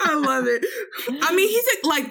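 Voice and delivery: high laughing voice; real laughter. Real, not acted. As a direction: laugh through it. Laughing after joke.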